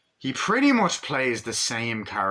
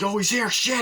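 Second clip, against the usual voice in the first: alarmed; 12 semitones higher, 6 dB louder than usual. Acted. Character alarmed, acted.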